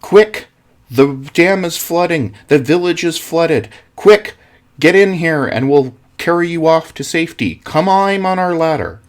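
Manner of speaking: loud, high shouted rescue call; shouting through a megaphone. Acted. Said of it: "and he shouted down".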